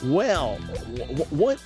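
ordinary talk, nothing marked

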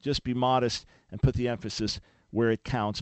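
ordinary talk

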